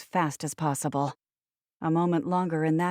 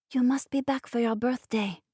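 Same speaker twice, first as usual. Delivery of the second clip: put-on higher-pitched little boy with French accent; lilting French accent; 6 semitones higher than usual. A narrator's reading. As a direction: voice a small French-accented child. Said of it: "he'd whispered in his lilting French accent".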